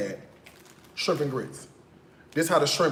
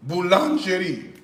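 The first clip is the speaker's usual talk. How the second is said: Boulangerie said in a hesitant attempt at a French accent; French accent try; 2 semitones higher than usual. Shown as a demonstration. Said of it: "what's the French accent?".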